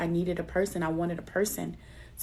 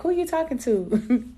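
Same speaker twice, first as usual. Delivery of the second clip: imitating family; 6 semitones higher, 5 dB louder than usual. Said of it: "they be like".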